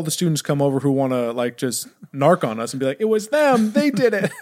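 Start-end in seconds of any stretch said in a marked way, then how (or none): 3.0-4.3 high-pitched accusing snitch; mock tattling. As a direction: imitate kids eagerly pointing the blame. Kids narcing at tribunal, imitated.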